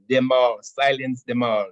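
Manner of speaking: put-on loud playful Caribbean accent. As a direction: announce the DJ name in a Caribbean accent. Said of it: "you gotta say in the Caribbean accent".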